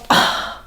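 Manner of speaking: soft voiceless "oh" sigh; exasperated sigh. Acted. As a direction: let out an acted, deflated breath. Acts out watchers' letdown.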